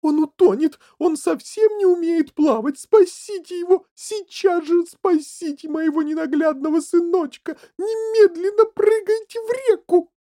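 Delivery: very high, loud squealing panic; frantic shriek. A narrator's reading. Mother whose son is drowning, frantic shrieking. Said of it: "заверещала бедная мать".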